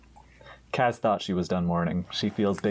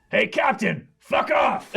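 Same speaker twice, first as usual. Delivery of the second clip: high, raised call across deck; defiant shout; 12 semitones higher, 3 dB louder, slower than usual. Acted. [name] telling dream captain off, high defiant shout. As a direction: yell it gleefully at your boss.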